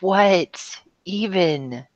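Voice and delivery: slow stunned confusion; drawn-out bafflement. Retold or imitated. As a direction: stretch it out, totally lost. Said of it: "And I'm like".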